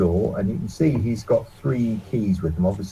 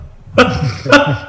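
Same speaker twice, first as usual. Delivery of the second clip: laughing; 5 semitones higher, 9 dB louder than usual. Real, not acted. GM laughs at player.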